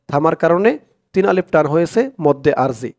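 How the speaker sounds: usual speaking voice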